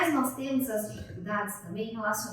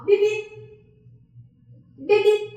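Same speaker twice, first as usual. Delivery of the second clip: high, loud playful horn beeps; car horn beeps; 9 semitones higher, 8 dB louder, slower than usual. Shown as a demonstration. Therapist honking like a toy car for the child. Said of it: "Aí eu vou fazer pra ela assim".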